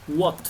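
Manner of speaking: English word What said clearly for learners. Shown as a demonstration. Teacher models What for Spanish students, clearly. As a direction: model What slowly for the class. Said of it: "¿Cómo se dice qué?".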